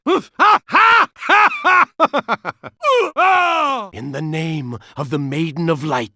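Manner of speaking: ordinary delivery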